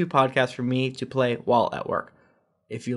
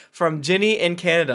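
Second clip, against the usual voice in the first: ordinary delivery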